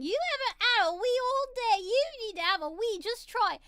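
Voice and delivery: put-on high, loud British mum scolding her child; British mother yelling. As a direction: yell it like an exasperated British mother. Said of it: "a very extremely British mother yelling".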